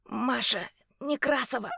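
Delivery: calling out through chattering teeth; shivering call with teeth clacking. Acted. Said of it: "клацая зубами".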